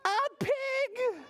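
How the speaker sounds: very high, stretched surprise; amazed reveal. A narrator's reading. Reader showing children what vet pulled out, amazed. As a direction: squeal out the discovery for children.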